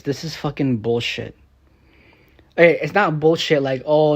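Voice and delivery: usual speaking voice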